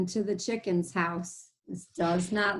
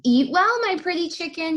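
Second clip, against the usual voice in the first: higher and louder, sly wolf call; wolf crying out; 8 semitones higher, 5 dB louder than usual. Acted. Teacher voicing the wolf calling to the chicken, sly and loud. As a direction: cry it out sweetly like a greedy wolf. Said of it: "he cried".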